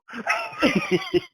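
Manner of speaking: squealing high laugh. Real, not acted. Laughs at her own modesty.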